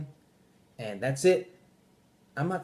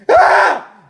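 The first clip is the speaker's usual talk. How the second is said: put-on sharp high squeal, like a baby that is a noise; mock baby shriek; 17 dB louder than usual. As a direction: squeal it out as the kid's name.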